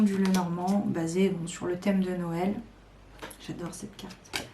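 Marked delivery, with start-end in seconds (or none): 3.5-4.5 whispered gush. Real, not acted. Gushing over her favourite card.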